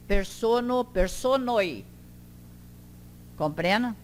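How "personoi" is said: PERSONOI ending in an oy glide; slow PERSONOI, closing on I; final J as I. Shown as a demonstration. Teacher shows the plural, PERSONOI ending in I glide. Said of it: "Sempre com J".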